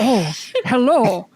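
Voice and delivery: slow, settled greeting in the captain's voice; corrected captain voice. Acted. GM restarts the CSI captain's greeting in the proper voice. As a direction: greet them again, now in the right voice. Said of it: "that's the wrong accent".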